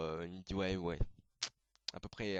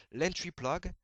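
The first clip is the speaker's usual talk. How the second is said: "entry plug" said the English way, higher and louder in an English accent; slipped English accent; 7 semitones higher, 6 dB louder, slower than usual. Real, not acted. YouTuber explaining Evangelion terms slips into an English accent. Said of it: "mon accent anglais est sorti d'un coup".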